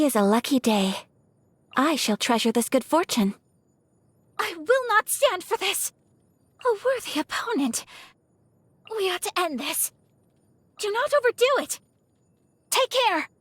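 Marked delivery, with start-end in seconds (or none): 4.4-13.3 high, urgent fighting lines; combat exclamations. Acted. Lady fighter to foes and allies in battle, high urgent calls. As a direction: call it out high in the thick of a fight.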